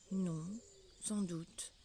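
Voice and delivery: douce et plus basse, pour apaiser; voix caressante. Acted. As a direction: consoler la souris tout doucement. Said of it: "avec une voix caressante pour l'apaiser". (Alice apaise la souris fâchée, d'une voix caressante)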